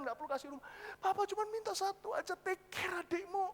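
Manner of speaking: put-on high, plaintive old father's plea; pleading father. Father who never asked for anything begging, plaintive.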